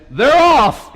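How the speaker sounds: high, loud race call; announcer's call. Retold or imitated. Racetrack announcer starting the horse race, loud call.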